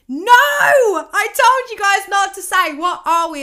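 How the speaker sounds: loud, high, slow exasperated cry; self-scolding shout. Real, not acted. To her viewers, a loud slow exasperated cry. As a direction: yell at yourself for the mistake. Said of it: "scolds herself for saying it".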